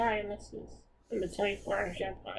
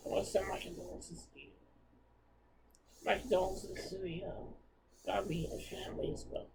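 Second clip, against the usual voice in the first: choked-up near whisper, softer; fighting back tears; teary; 6 dB quieter than usual. Real, not acted. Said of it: "I got a little teary out there".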